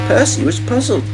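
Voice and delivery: plain reading voice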